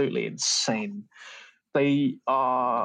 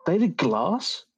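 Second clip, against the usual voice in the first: rising incredulous question, leaping higher; amazed disbelief; 9 semitones higher than usual. Real, not acted. Podcaster finding favourite films on the studio's list, high astonished disbelief. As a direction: blurt it out high, as a startled question.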